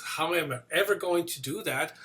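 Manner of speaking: normal talking voice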